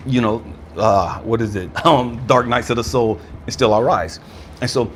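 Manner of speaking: ordinary talk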